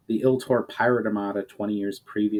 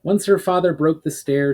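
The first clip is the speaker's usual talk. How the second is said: ordinary delivery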